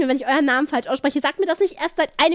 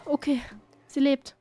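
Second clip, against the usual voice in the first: usual speaking voice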